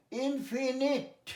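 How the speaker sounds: ইনফিনিট slowly with short i sounds; correct pronunciation. Shown as a demonstration. Teacher modelling the right word slowly for the class. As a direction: draw out ইনফিনিট short and clear. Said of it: "ইনফাইনাইট নয়".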